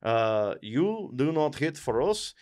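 put-on halting, stilted Russian accent; mock Russian soldiers. Soldiers confronting the Tsar at his palace, mock Russian accent. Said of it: "the group of soldiers comes up, and they're just like".

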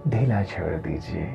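ordinary delivery

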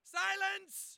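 very high shriek for order; loud, piercing shouted order; tyrant's command. Acted. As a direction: scream it to shut the crowd up. Gunman silences muttering crowd.

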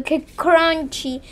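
slow, savoring crunchy; drawn-out crunchy. Shown as a demonstration. To viewers, slow savoring as the slime crunches. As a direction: stretch it out to sell the texture.

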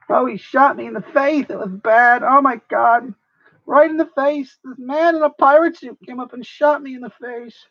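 high hammy complaining voice; high-pitched mock lament; whiny victim. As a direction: wail about being shot.

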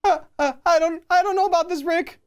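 high, hesitant, jittery sidekick; nervous sidekick voice. Acted. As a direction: stammer it out like a nervous kid sidekick.

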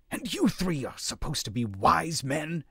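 can't believe what he's seeing; disbelief. A narrator's reading. Warrior scolding the brawling elders in disbelief. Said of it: "he asked in disbelief".

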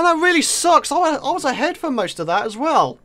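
usual speaking voice

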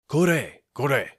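loud, high, gruff calls; rough exclaiming. Acted. Soba seller roughly calls to the man at his feet. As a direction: bark at the man to get a grip. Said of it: "roughly exclaimed the soba man".

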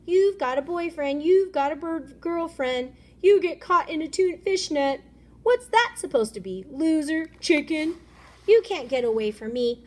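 put-on high, loud jeering kid; bully taunts. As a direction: hurl each taunt like a bully.

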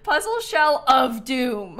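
loud, slow dramatic title read; all caps. Real, not acted. Reading the creator's all-caps name, dramatic. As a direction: boom out the name like a title card. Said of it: "in all caps".

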